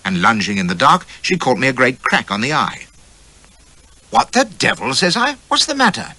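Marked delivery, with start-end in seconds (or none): 4.1-6.2 surprised. A narrator's reading. Narrator voices his surprise.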